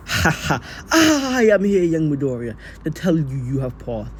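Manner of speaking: booming, drawn-out heroic announcement; All Might. Acted. Buffed-up hero tells young student he passed, mock-heroic boom. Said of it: "The skeletal man would buff up".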